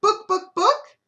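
very high, loud rising cluck; clear hen cluck. Acted. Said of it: "clucked the hen quite clearly".

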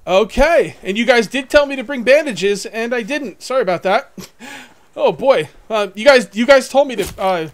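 loud, high, breathless after the fight; words tumbling out, pumped; adrenaline rush. Real, not acted. Streamer telling viewers after the fight, loud and pumped. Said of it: "my adrenaline is spiking big time".

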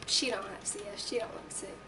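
ordinary delivery

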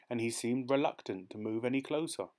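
ordinary delivery, nothing marked

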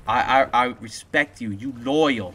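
slow catchphrase impression with big pauses; imitated catchphrases. Streamer quoting a man's sayings to supporters, mock-solemn impression. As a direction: deliver each phrase like someone's signature motto. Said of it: "Something else he says".

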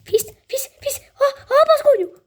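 high, loud anxious yelps; a real nervous shout at the screen; tense excited cries. Real, not acted. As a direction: cry out under pressure. Tense moment in match.

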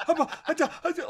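put-on high, halting little boy; stammering scared kid. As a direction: stumble over the words, terrified. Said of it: "like this".

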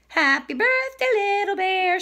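excited hen arriving at a party; cheery birthday greeting. Acted. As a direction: call it out high and festive. Hen greets Little Bear on birthday.

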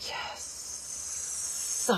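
long, breath-only whisper of satisfaction; soft, hissed triumph; whispered relish. Real, not acted. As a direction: savor it in a drawn-out whisper.

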